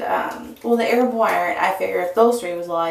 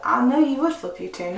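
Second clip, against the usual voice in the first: ordinary talk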